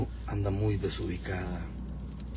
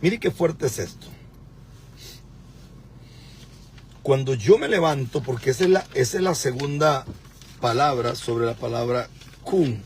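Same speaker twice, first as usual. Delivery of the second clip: loud, emphatic preaching; 3 semitones higher, 5 dB louder than usual. Real, not acted. Teaching viewers about the word, preaching. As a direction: proclaim it with raised voice.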